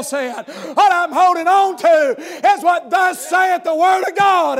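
loud, ringing preacher's cadence, building each line; preaching at full force. Acted. Preacher rousing the congregation about God's help, full-voiced preaching. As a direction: thunder it out to the church.

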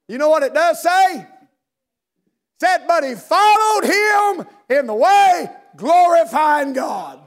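high, loud, slowed shouting climax; preaching at full force. Acted. Preacher shouting the healed man's praise to the congregation. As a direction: lift the church with the victory line.

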